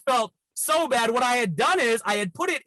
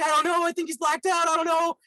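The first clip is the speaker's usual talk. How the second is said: high-pitched mock fretting; lightly acted panicky phone report; retold freaking out; 8 semitones higher than usual. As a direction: imitate her helpless worry, pitched up. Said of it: "she's freaking out".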